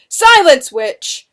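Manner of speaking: loud, high burst on the first word; booming scream. A narrator's reading. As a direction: scream her into silence. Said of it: "Benjamin screamed, his booming voice".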